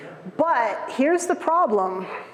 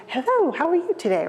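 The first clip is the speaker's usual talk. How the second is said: higher, polite phone greeting; prim phone-answering voice, pitched up; telephone voice; 7 semitones higher than usual. Retold or imitated. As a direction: answer the phone on best manners. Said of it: "a telephone voice".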